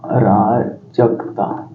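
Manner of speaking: अराजकता drawn out very slowly and softly; teacher repeating for notes; slow dictation. Shown as a demonstration. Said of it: "energy मतलब होता है अराजकता".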